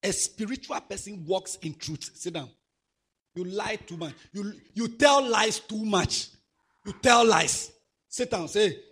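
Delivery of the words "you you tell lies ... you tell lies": loud slow rebuke of a liar; scolding rebuke. Acted. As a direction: drag out each word to shame him. Scolding a child in church.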